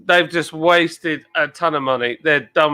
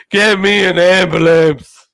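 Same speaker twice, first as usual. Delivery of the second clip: loud, slow mock cry for help; Vietnam Tom; 7 dB louder, slower than usual. Retelling a beaten-up man's plea, mock yell. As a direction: holler like a bleeding man in a viral video. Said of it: "bleeding from everywhere, and he's like".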